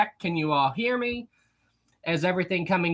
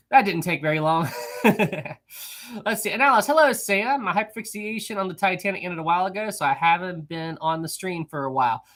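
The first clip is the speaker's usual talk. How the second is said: usual speaking voice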